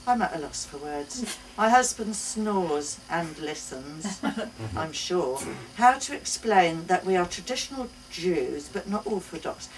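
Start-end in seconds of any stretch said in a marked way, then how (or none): none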